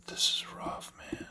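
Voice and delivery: breathy whisper, barely there; a deflated whisper after a blunder; dejected whisper. Real, not acted. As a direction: whisper it to yourself, defeated.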